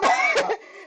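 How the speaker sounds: a short, high real laugh. Real, not acted. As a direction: laugh at the memory. Laughs at her dream of Ellis.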